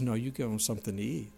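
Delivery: soft, firm answer. Acted. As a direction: answer calmly, with quiet authority. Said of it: "Jesus says".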